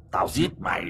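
low, gritted murderous threat; teeth-gritted threat. A narrator's reading. Possessed Hùng threatening Uncle Vó, low teeth-gritted menace. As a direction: threaten through clenched teeth. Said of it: "nghiến răng nghiến lợi".

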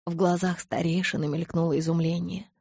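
usual speaking voice

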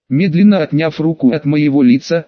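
plain reading voice

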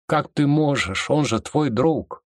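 high, slow cry of horror; aghast, pitched high and drawn out; horrified. Acted. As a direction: gasp it out, appalled. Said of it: "Закрывая рот ладонью от ужаса".

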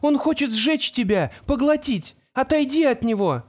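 high-pitched, panicked warning; frightened shouting. A narrator's reading. Girl begs friend to step back, high panicked cry. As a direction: urge him away in a high, frantic cry. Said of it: "закричала Тамара".

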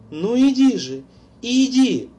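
put-on high, pressing ghostly girl; urging girl's voice. A narrator's reading. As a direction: coax him on, insistent. Laura urging on phone.

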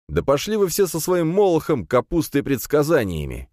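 high, fed-up outburst; sharp retort. A narrator's reading. Hero dismisses the priest's prophecies, sharply. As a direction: lash out, pitch up. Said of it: "резко ответил я".

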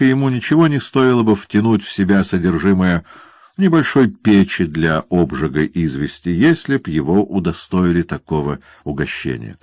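ordinary delivery

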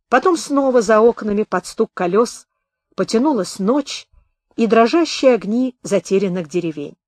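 ordinary delivery, nothing marked

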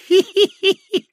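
very high, loud creepy tittering; high old man giggle. A narrator's reading. (мерзкое старческое хихиканье)

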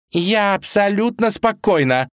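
high, insistent false calm; furious. Acted. Vasilisa denies being upset to Ivan, furious. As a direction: insist on being calm while boiling over. Claims calm, stamps her foot.

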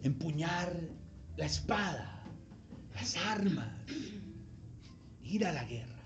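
solemn low delivery; hushed intensity. Real, not acted.

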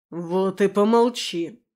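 drawn-out irritated command; growled order. A narrator's reading. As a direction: order it slowly, patience gone. Werewolf keeps growling at Lera.

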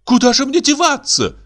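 very high, aghast wife; theatrical feminine shock; dismayed gasp. A narrator's reading. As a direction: cry out in high-pitched dismay. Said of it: "ахнула Каролина".

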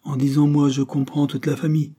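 reply with playful mock importance; comical. A narrator's reading. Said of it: "répliqua comiquement Henry". (d'un ton comique)

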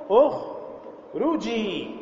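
اخرجي stretched out very slowly; very slow model word. Shown as a demonstration. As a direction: drag اخرجي out syllable by syllable. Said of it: "فنقول". (اخرجي بمد شديد وبطء)

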